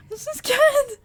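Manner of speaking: loud panicked wail; scared cry. Real, not acted. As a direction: cry it out in real fright.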